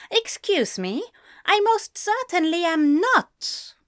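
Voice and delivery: high, affronted denial; offended, emphatic comeback; indignant retort. A narrator's reading. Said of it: "he retorted".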